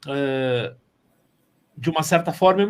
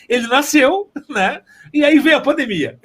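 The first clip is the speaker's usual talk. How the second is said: laughing; 8 semitones higher, 4 dB louder than usual. Real, not acted. Laughs about show debuting before pandemic.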